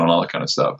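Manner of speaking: ordinary talk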